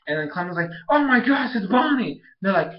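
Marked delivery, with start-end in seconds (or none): 0.9-2.1 higher-pitched mock gasp of recognition; retold startled cry; shocked discovery. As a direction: act out spotting a missing friend. Acted shock at spotting Bonnie.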